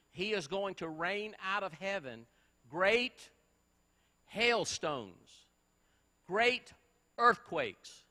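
loud, rising preacher's thunder; preaching at full force. Acted. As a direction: proclaim the judgment, building to great earthquakes. Preacher building to a climax.

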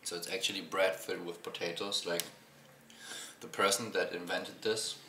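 eating while speaking; talking while chewing. Real, not acted. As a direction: mouth partly full of bread. Seen 4: Bites paratha at -4, jaw still working while talking.

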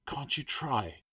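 high, hushed plea; pleading. A narrator's reading. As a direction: plead with him under your breath. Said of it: "Claire pleaded".

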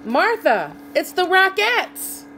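high, loud, slow thrilled call; excited announcement. A narrator's reading. Farmer showing his cow the dancers, thrilled. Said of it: "Rob said".

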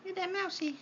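very high squeaky cheer on mousey; squeaky pet cheer. Real, not acted. Owner cheering Helix to attack the toy mouse, very high. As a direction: cheer the bird on in a tiny high voice.

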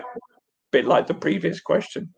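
usual speaking voice

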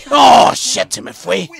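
loud, high curse; frustrated shout. Real, not acted. Gamer as the ghost slips away, shouting in dismay. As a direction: bark it out in dismay.

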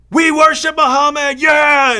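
hammed-up crowd-rousing yell; triumphant shout. Mocking a debater rousing the cheering crowd, loud shout. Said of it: "in the right voice with the right intonation".

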